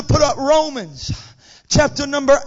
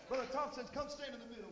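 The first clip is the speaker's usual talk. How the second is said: usual speaking voice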